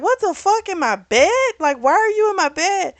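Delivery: high, loud retold outrage; indignant what-the-fuck; shocked outburst. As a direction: yelp it in disbelief. Logan finds Amber in his bed.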